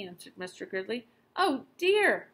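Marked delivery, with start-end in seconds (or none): 1.4-2.3 much higher, louder distressed mother; dismayed. Acted. Mother hearing the shop is locked, high distressed cry.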